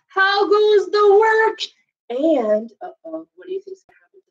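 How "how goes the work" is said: much higher, slow called question; calling farmer. Acted. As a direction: call out from the bed.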